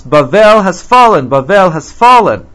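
lifted, emphatic declaration; announcing. Acted. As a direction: announce it like a herald. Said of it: "They announce".

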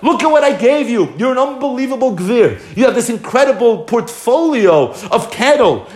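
high, emphatic reproach; acted rebuke. Prophet reminding king of all he was given, reproachful. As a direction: press the reproach, pitched well up.

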